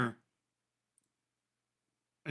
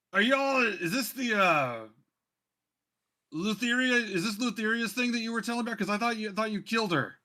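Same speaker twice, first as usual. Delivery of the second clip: high, insistent questioning; raised-pitch woman calling up in disbelief; Bulbug's mother; 9 semitones higher, 4 dB louder than usual. Acted. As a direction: demand answers in a high voice. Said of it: "she looks up and she points up and, and says".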